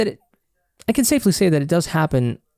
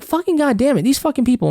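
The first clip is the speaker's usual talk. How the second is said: much higher, pitched-up angry cursing; retold road rage; 10 semitones higher than usual. Driver cursing at cars still turning, pitched-up mock fury. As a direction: replay the frustration of being stuck at the green light.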